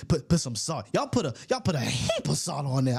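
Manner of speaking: imitating a Southern US twang; down-south talk. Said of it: "them down south people say".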